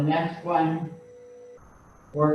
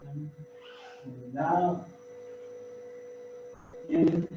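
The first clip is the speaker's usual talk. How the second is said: gently pace the class's breaths, quieter; guided breathing cues; 2 semitones higher, 7 dB quieter than usual. Acted. Instructor pacing the class's breaths, soft and calm.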